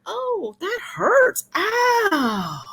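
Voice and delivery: high, drawn-out wince; mock pained sympathy. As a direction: wail softly as if it hurts you. Reacting to dating horror stories.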